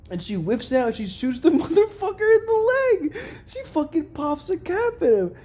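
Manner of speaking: high, loud, gleeful outburst; thrilled storytelling. Real, not acted. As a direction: relish the big moment, nearly laughing. Thrilled retelling of the shooting.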